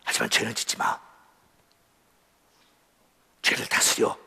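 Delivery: hushed, breathy urging; whispered warning. Real, not acted. Preacher warning the congregation not to sin, whispered. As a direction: whisper it like a secret plea. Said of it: "whispered urging".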